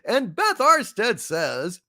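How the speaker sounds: normal talking voice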